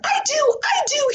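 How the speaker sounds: put-on high, eager kid calling out; eager shout. Sheldon raising his hand to answer teacher, high eager call. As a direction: jump in, desperate to answer. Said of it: "he shouted".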